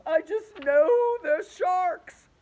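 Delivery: high, loud, slow frightened shout; panicked earthworm shouting; fearful shout. A narrator's reading. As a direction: yell the certainty in terror. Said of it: "said the Earthworm".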